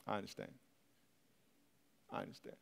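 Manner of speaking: low, very soft retold reply; quiet acceptance. Friend refused the baby accepts it, very quietly. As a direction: say it barely aloud, accepting the refusal.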